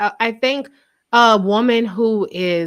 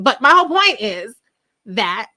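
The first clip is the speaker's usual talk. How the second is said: usual speaking voice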